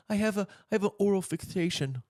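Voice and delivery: high lispy Tyson voice; Mike Tyson impression. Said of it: "That's my Tyson impression".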